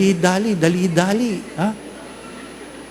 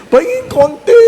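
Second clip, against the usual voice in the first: much higher, louder whiny plea; high loud mock wheedle; mock begging; 6 dB louder than usual. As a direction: beg shrilly for a share. Begging for a bit.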